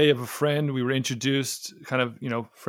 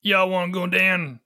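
deep Appalachian accent; 5 semitones higher than usual. Shown as a demonstration. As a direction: put on a thick Appalachian twang. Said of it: "that's like deep Appalachian".

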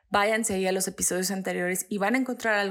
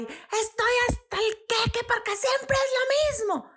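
much higher, whiny shouted complaint; mock yelling rant; 13 semitones higher than usual. Shown as a demonstration. Host mocking the wrong way to vent anger, high-pitched mock rant. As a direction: squeal it out like a fed-up rant. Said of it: "no es lo mismo a gritar y parlotear".

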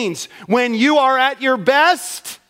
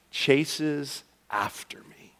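whisper; 7 semitones lower, 10 dB quieter, much slower than usual. Acted. Pastor reveals word's meaning.

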